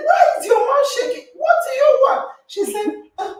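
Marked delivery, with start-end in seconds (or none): 0.1-2.2 much higher and louder, fast; eager quickfire questioning; excited question. Retold or imitated. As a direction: press her for an answer with high energy. Retold urgent question to Rachel.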